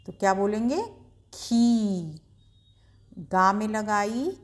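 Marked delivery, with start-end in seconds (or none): 1.3-2.2 खी with the ii drawn out and stressed; stretched long ii. Shown as a demonstration. Teacher showing children the long ii, drawn out. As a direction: hold the ii in खी and press it. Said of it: "शब्द पर ज़्यादा जोर देकर बोलते हैं".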